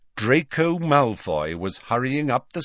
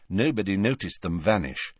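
plain reading voice

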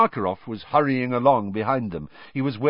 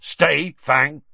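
loud, raised order to a dog; loud, higher command; 6 semitones higher, 5 dB louder than usual. A narrator's reading. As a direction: call out a short command. Hagrid commands his dog.

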